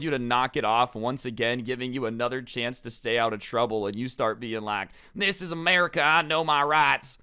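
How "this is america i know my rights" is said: high, loud parody of protest; hammed-up defiant declaration; mock outrage. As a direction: holler it like a self-righteous loudmouth. Mocking the kid's protest.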